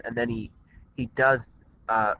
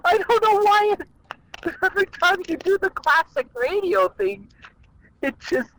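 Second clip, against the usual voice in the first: high laughter through the words; laughing; 3 dB louder than usual. Real, not acted. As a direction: giggle helplessly while talking. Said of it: "You're an easy laugh".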